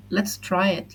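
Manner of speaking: ordinary talk, nothing marked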